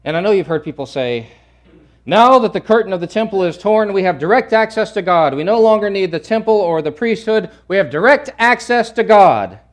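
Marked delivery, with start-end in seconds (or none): 2.1-9.6 loud, high, sustained declaiming; imitated zealous churchgoer at full volume; enthusiastic proclaimer. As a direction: proclaim it like thrilling news, raised voice. Voices what people say, enthusiastically.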